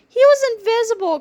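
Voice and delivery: high, fearful declaration; scared cry. A narrator's reading. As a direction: cry it out, spooked. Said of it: "cried George".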